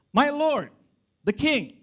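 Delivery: high, loud call across a distance; David hailing Saul outside the cave, loud and high. Retold or imitated. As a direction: call out to the king from afar. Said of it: "he shouted".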